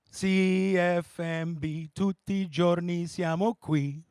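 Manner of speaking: low, very slow drawn-out radio announcer; low slow Italian radio ID; radio jingle. Retold or imitated. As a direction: croon the station ID like an old jingle.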